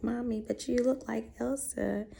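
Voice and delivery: put-on high, light little-girl voice. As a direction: voice her daughter sweetly and high. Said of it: "She was like".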